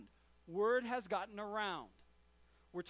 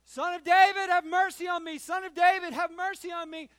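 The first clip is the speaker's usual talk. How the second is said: desperate yell, much higher and louder; 9 semitones higher, 14 dB louder than usual. Acted. Blind beggar calling to Jesus past the crowd: desperate yell. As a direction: cry out over the crowd, begging. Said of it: "he starts yelling".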